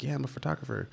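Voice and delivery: soft, high, retold unease; anxious admission. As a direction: imitate a timid self-introduction. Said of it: "so much anxiety to be like".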